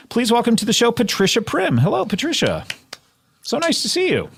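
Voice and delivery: high, showy host intro; announcer welcome. Acted. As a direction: sell the guest's entrance big. Host's grand guest intro.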